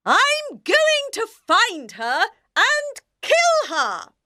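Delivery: high, slow vicious threat; furious shout. Acted. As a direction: spit out the threat like a villain. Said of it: "shouted the Queen".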